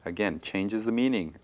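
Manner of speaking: usual speaking voice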